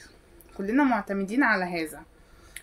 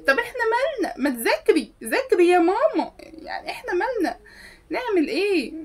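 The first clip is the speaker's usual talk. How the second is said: much higher, indignant whining protest; shrill mock-offended complaint; exasperated classmate; 8 semitones higher than usual. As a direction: throw up your hands, it's not our problem.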